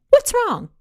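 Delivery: startled worried question; sharp surprise; alarmed. Acted. Sudden concern.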